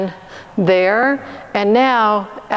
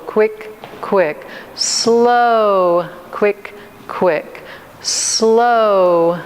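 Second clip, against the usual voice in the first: drawn-out slow count; much slower than usual. Shown as a demonstration. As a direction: stretch each "Slow" long, then clip "quick, quick" short. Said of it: "say it in a way that it kind of draws it out".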